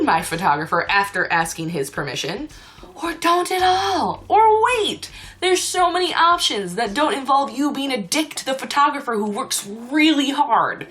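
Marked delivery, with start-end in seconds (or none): 3.0-10.9 exasperated rant. Real, not acted. Vlogger defending a hard-working photographer, exasperated rant. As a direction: push the pitch up and insist.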